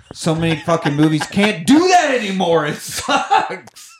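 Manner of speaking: building to a high, strained whine of frustration; exasperated rant. Real, not acted. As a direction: vent in rising exasperation. Rant about movies, ends exasperated.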